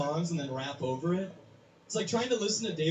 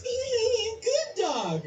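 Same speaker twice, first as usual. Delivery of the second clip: very high squeaky voice; the Doughboy looking at his own behind, high and squeaky. Retold or imitated. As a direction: chirp it like a tiny cartoon mascot. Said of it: "he goes".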